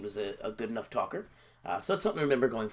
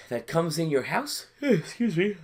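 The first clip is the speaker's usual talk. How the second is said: yawning through the words; 7 semitones higher, 4 dB louder, slower than usual. Real, not acted. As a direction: talk mid-yawn, then excuse it. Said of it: "I'm yawning here".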